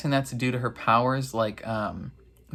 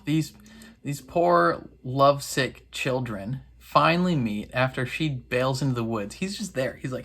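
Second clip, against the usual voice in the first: usual speaking voice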